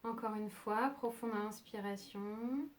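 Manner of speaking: soft, unhurried meditation voice with long pauses; calm yoga nidra guidance, leaving long silences; guided meditation. Acted. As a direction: guide them gently towards sleep, phrase by phrase.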